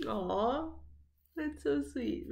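usual speaking voice

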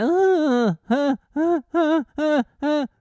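high-pitched mock baby wail in short repeated bursts; imitated baby crying. Dad mimicking his daughter's endless crying, whiny mock wail. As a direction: whine "ah" over and over like a crying baby. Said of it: "a mixture of crying and whining".

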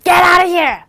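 shouted command to leave; angry shout. Shown as a demonstration. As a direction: order him out at full voice. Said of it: "억양만 구분하셔서".

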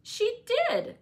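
very high, loud insistence; emphatic high-pitched comeback; crying out. A narrator's reading. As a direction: cry it out in a childish quarrel. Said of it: "cried my young lady".

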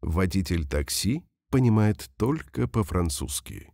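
ordinary delivery

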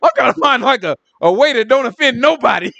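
half-laughing, half-crying at yourself; comic whiny exasperation. Retold or imitated. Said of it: "I was like".

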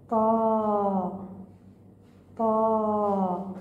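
each syllable طا, طو, طي held long and steady; sustained teaching syllables; drawn syllables. Shown as a demonstration. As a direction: chant the syllables slowly for the class. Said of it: "انظر وألفظ".

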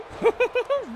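very high falsetto giggle; Mickey Mouse. Acted. Streamer doing requested impersonation, high cartoon mouse giggle. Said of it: "Can you do Mickey Mouse?".

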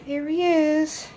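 high, slow cooing voice. Real, not acted. Showing newborn to viewers.

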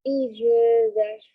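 slow, calm breathing-coach voice; guided breathing. Acted.

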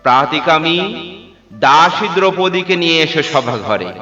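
ringing, haughty command; imperious command. Acted. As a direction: summon a slave before the court. Duryodhana orders his servant.

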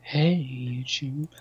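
slow, soft, spooky greeting; creepy voice. Shown as a demonstration. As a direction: drag it out like a ghost. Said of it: "I should have started it with a creepy voice".

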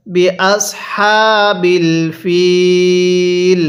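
very slow "বি আসহাবিল ফিল", long held "ফিল"; model recitation. Shown as a demonstration. Teacher models verse ending, very slow "বি আসহাবিল ফিল". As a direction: hold the "ee" of "ফিল" on a level note in "বি আসহাবিল ফিল". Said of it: "আমার সাথে পড়ুন".

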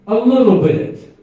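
a loud, slow, high sarcastic a little bit; preacher mocking a wrong reading, loud and slow; mock wrong answer. Shown as a demonstration. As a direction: feign the wrong reading to correct it. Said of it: "No, what does it say?".

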